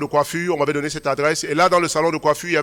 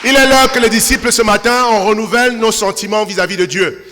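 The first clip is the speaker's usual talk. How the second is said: loud, ringing sermon delivery; full-force preaching; 5 semitones higher, 5 dB louder than usual. Acted. Pastor exhorting the congregation, in full preaching voice. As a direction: preach it out to the whole church.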